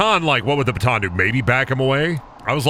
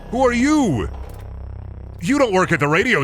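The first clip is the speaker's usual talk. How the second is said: mock surprise; 9 semitones higher than usual. Surprised high goofy voice.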